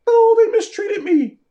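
high, loud mocking whine; mock whiny victim. Mocking people who complain about mistreatment in a high whiny voice. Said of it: "Nobody hears you crying".

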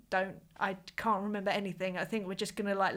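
ordinary talk

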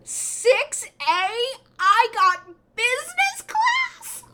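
loud, incredulous squeal rising far above normal; high-pitched excitement. Retold or imitated. Passenger discovering her business class seat, high-pitched excitement. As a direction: squeal it in delighted disbelief. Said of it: "I'm sorry for my high-pitched voice".

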